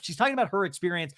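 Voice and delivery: usual speaking voice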